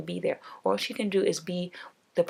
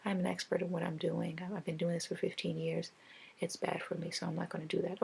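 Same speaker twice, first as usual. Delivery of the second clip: soft, polite, firm refusal; calm, courteous no to the therapist; 7 dB quieter than usual. Pretend. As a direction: turn them down gently but don't budge. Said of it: "very politely be like".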